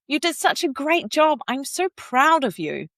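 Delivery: really mean the praise, lift it up; energetic praise. Shown as a demonstration. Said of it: "adding that energy into your voice".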